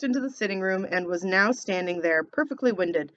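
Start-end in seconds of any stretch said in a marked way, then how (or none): none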